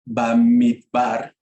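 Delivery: "Bamidbar" slowly, in separated syllables; pronunciation demo. Shown as a demonstration. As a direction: sound out "Bamidbar" carefully in amateur Hebrew. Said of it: "lo voy a decir así".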